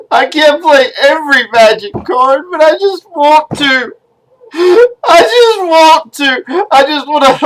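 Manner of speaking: breaking down into tearful pleading; crying. Acted. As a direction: wail it like a heartbroken child denied a treat. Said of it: "I promised myself I wouldn't cry".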